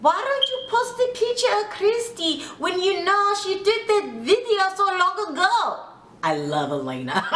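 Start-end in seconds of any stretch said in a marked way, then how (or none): 0.0-5.8 high-pitched imitation Russian accent; little Russian accent. Castmate challenging the posted picture, mock Russian accent. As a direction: put on a Russian woman's accent, pitched high, confronting her. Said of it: "in her little Russian accent".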